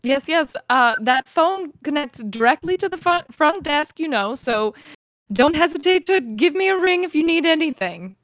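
lightly voiced front-desk host offering help; [name], obliging host.

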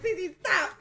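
high, loud laughing plea. Retold or imitated. Crystal begging Cece to stop teasing, high laughing plea. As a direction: beg through laughter.

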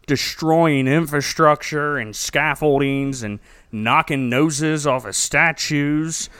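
ordinary delivery

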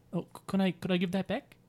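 hesitant, polite New Zealand plea; New Zealand accent. Acted. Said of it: "it's like a New Zealand kind of".

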